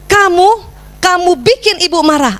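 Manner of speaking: high, pointed accusation; accusing scolding. Shown as a demonstration. Mother wrongly blaming her child, accusing scolding. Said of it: "Banyak kita begini".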